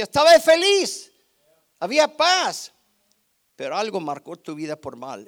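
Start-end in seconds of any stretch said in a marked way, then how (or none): 0.0-2.7 much higher and louder, bursting with joy; joyful preaching. Acted. Preacher to congregation, exclaiming joyful peace. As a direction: lift it up like remembered happiness.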